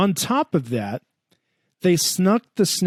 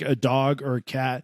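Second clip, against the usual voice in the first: normal talking voice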